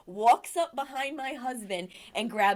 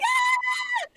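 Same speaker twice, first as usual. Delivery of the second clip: loud high-pitched scream; sudden high yell of shock; 8 dB louder than usual. Retold or imitated. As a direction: shriek in shock. Retelling shock at shaved husband.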